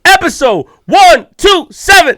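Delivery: loud, high, drawn-out shout; celebratory show-opening holler; hyped announcement. Real, not acted. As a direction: shout each number slowly like a hype man.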